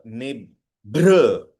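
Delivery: "Nibhru" with a breathy, aspirated bh, said loud, then a clear ra; aspirated bha first. Shown as a demonstration. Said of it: "say the Mahaprana word first".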